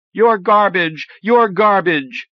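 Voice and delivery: put-on loud, high child's yell, the repeat slow; shouting. A narrator's reading. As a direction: scream the insult high and loud, drawing out the second. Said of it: "she shouted".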